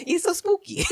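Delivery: very high cartoon accent; Mario. Joking [name] finds the castle spooky, high cartoon voice. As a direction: pitch it way up in a silly put-on accent. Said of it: "now I'm Mario".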